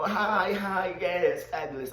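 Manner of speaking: a hint of a gushing socialite; schmoozing greeting. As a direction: play the friendly schmoozer working the room. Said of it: "'Cause me, I'm a schmoozer".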